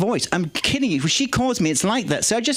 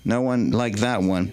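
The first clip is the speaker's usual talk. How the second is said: ordinary talk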